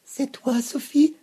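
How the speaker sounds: high, loud, gulping question; hiccupping. A narrator's reading. Vénou hiccups at seeing Sophie. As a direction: gulp the words in shock. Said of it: "hoqueta-t-il".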